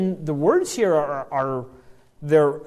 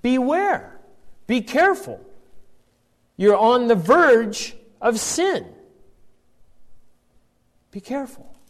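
gentle warning; 5 semitones higher than usual. Acted. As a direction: warn him tenderly, like a worried parent. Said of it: "but it's, it's gently done".

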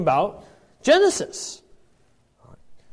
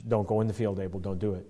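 softer, hushed plea to a character; pleading aside; 10 semitones lower, 10 dB quieter, faster than usual. Acted. Preacher quietly urging Abel not to go into the field. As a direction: murmur the warning like talking to the screen.

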